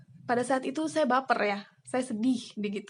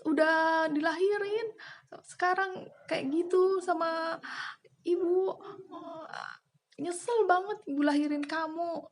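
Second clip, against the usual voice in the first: crying angry mother; 7 semitones higher than usual. Retold or imitated. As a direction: wail like a hurt, furious mother. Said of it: "Marah ibu saya".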